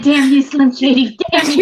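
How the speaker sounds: playful cursing while cracking up; laughing mock curse. Joking impression amid group laughter.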